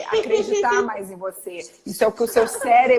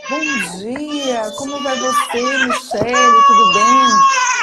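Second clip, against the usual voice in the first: gentle sweet voice; 4 semitones higher, 7 dB louder than usual. Shown as a demonstration. Doctor greeting a patient, in a gentle sweet voice. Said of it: "Com a voz mansa".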